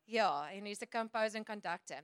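usual speaking voice

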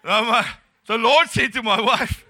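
loud, high mock-emphatic blurt; escalated comic excuse. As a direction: correct yourself loudly, playing it for laughs.

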